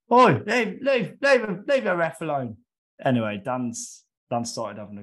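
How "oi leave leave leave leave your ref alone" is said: high, loud mock shout from the touchline; imitated yell. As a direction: bellow it like an angry spectator. Spectator yelling from the sideline.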